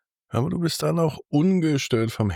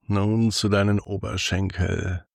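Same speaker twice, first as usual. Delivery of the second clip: sultry narrator. Acted. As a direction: lull the listener toward sleep, unhurried. Guided body scan for sleep.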